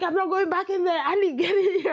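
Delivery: high, loud, fast frightened protest; retold panic, high and loud; panicked. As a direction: blurt it out in a scared rush. Fleeing ghost, refusing to return.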